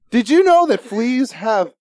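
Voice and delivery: slow, drawn-out southern twang question. Retold or imitated. Imitating the little brother at midnight, slow southern twang. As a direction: drag it out like a country kid. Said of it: "a super southern twang to him".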